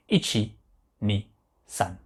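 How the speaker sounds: "Ichi, ni, san" as ee-chee, nee, san, spaced apart; Japanese pronunciation. Shown as a demonstration. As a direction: recap "Ichi, ni, san" low, one word at a time. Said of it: "nous le prononçons san".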